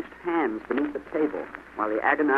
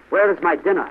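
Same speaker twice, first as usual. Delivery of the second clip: loud, high demand; demanding cry; 6 semitones higher, 5 dB louder than usual. A narrator's reading. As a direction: demand it like a rude sergeant. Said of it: "he cried".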